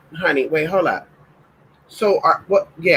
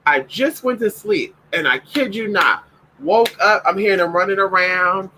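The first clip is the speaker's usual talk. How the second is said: usual speaking voice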